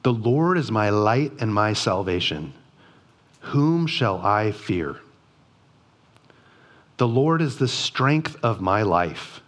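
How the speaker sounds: measured devotional reading voice; scripture reading. Acted. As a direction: let each line land low and solemn. Reads Psalm 27 aloud.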